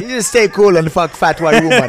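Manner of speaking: high, loud Jamaican voice; Jamaican accent. Retold or imitated. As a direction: lay on a laid-back Jamaican accent. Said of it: "one of my favorite accents".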